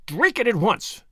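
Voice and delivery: imperious command. A narrator's reading. Captor forcing the glass on captive, imperiously. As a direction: order it imperiously. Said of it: "Imperiously she pressed the glass".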